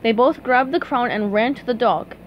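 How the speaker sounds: usual speaking voice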